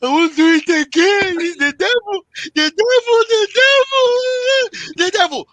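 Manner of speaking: high wailing crocodile-tears apology, the devil stretched out; hammed-up sobbing excuse, voice climbing on devil; mock pleading husband. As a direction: whine it high like a man faking remorse. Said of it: "they will".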